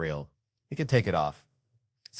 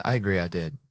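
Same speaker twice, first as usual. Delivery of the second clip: ordinary delivery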